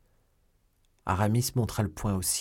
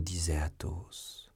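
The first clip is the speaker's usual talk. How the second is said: ordinary delivery, nothing marked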